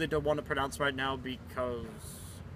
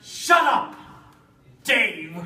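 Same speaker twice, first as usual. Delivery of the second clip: snapping shout; 7 semitones higher, 5 dB louder, much slower than usual. Pretend. Snaps at Dave.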